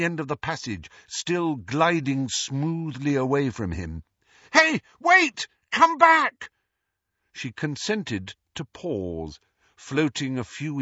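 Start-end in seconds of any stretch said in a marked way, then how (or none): 4.5-6.5 high, loud shout after someone leaving; calling out. A narrator's reading. Harry calls after the departing ghost, urgent shout. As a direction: yell for them to stop. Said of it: "had not meant to shout".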